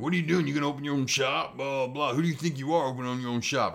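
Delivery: hammed-up sneer at someone opening a shop; jealous coworker. Rival tattooer mocking him for opening his own shop, mock jealous sneer. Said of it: "the jealousy comes in".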